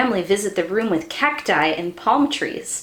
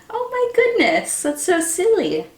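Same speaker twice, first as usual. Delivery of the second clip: excited squeal; 8 semitones higher than usual. Real, not acted. Rain turned on indoors.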